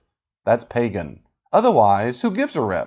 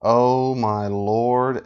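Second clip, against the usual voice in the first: slow, drawn-out sigh of disbelief; weary exasperation; 2 semitones lower, much slower than usual. Real, not acted. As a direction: stretch it out, fed up. Exasperated reaction to the clip.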